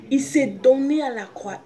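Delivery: ordinary talk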